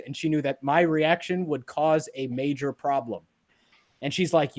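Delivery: usual speaking voice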